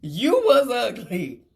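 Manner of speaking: slow, drawn-out tease; slow teasing. Real, not acted. Rubbing it in to the viewers, slow and teasing. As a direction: stretch it out to rub it in.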